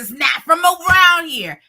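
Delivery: high, loud objection; acted indignant protest; indignant retort. Retold argument over the bug.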